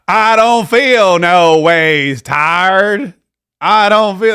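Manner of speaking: loud, very slow mock Southern drawl; Hillary Clinton's pandering accent. Host mocks a candidate pandering to voters in a Southern accent. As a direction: drag out the put-on Southern accent, loudly. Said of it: "Hillary Clinton trying to pander".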